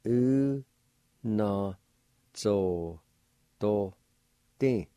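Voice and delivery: U, na, zo, do, di broken apart with a pause after each; syllable by syllable. Shown as a demonstration. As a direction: spell out U na zo do di as separate sounds. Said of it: "Second part".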